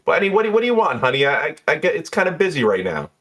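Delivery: high-pitched, harried bartender; busy bartender with no time to chat; Jeannie. Acted. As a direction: hurry the caller along in a high voice. Game master plays bartender.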